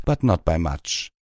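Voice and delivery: plain reading voice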